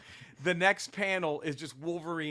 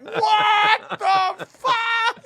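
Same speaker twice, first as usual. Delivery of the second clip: shrieked high and loud through a laugh, drawn out slowly; what the fuck, dragged out in a high laughing squeal; stunned laughing disbelief; 12 dB louder, much slower than usual. Retold or imitated. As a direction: let the shock burst out as a laughing squeal. Shocked reaction to comic reveal.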